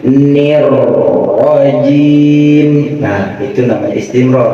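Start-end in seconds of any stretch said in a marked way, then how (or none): 0.1-3.0 "nir rajim" drawn out slowly with the r flowing; slower. Shown as a demonstration. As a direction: say it more slowly. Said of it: "Dipelanin lagi".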